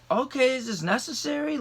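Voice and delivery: high-pitched retold objection; incredulous protest. Handcuffed driver questioning the cop, incredulous protest.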